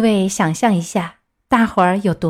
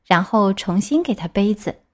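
usual speaking voice

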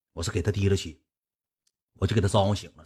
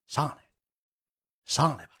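mimicked higher-pitched coaxing; 3 semitones higher, 5 dB quieter than usual. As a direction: gently urge her up, in mimicry. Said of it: "赖就搁那哄人家". (学人小声哄着叫上来)

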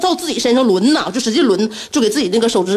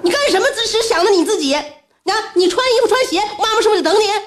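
high-pitched mock-hysterical scolding; retold angry outburst; 10 semitones higher than usual. Overwhelmed mother berating her son in the retelling, high-pitched outburst. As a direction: fire indignant questions, high and exasperated. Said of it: "我大声就控诉他".

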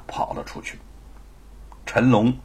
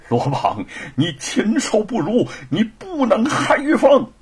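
angry cry climbing much higher; furious cursing; 10 semitones higher than usual. A narrator's reading. Bound husband rages at villain nearing his wife, furious cursing. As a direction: curse him helplessly, drawing out the last words. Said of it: "只有怒骂不休".

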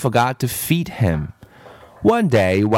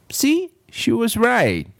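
mock gloat; 11 semitones higher than usual. A narrator's reading. Narrator echoes Kathy.

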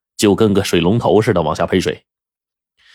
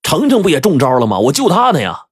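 higher-pitched, defensive reply; hurried excuse; 8 semitones higher than usual. A narrator's reading. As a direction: blurt out a ready justification. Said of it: "赶紧回答".

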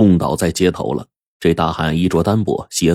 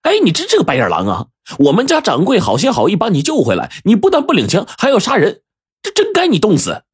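shrill, rising tirade, pitched far higher and climbing; indignant scolding; 13 semitones higher than usual. A narrator's reading. Shop boy scolds the ungrateful soldier, outraged. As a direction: scold the ungrateful guest to his face. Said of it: "这一个伙计就骂道".

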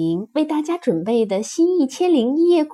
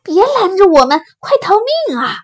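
high, loud, desperate plea; panicked shout; 8 semitones higher, 8 dB louder, faster than usual. Acted. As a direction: elephant yelling to get past. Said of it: "大象叫道". (惊慌地高声喊叫)

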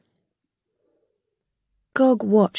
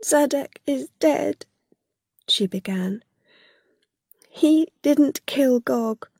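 tearful; 5 semitones higher than usual. A narrator's reading. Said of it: "began to cry".

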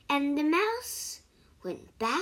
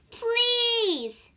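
much higher and louder plea; desperate shout; 11 semitones higher, 7 dB louder than usual. Acted. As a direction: shout it, begging. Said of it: "shouted the mouse".

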